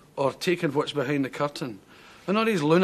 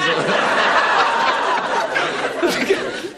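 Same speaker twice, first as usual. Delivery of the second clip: long helpless laugh; 9 semitones higher, 4 dB louder than usual. Real, not acted. Breaks down over chickens.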